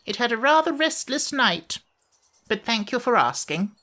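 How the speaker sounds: a high, fussy little creature voice; high creaky fairy. A narrator's reading. Sand Fairy grumbles to the children, high and fussy.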